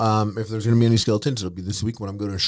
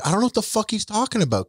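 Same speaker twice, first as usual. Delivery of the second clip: fast, much higher mock outrage; imitating Keem; 12 semitones higher, faster than usual. Retelling Keem denying the story, high fast mock voice. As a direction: squeak it out like a baffled Keem. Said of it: "apparently Keem was like".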